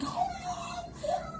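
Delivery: high, crying, anguished wail. Retold or imitated. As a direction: wail it out like bad news just hit. Retelling her reaction to pneumonia diagnosis.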